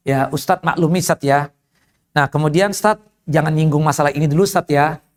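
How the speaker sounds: low, apologetic request; deferential briefing. Retold or imitated. Organizer briefing visiting ustaz on the audience, apologetic.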